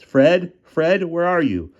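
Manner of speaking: high, loud call, slowing on the second. Acted. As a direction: call for Fred, growing worried. Calling for missing friend.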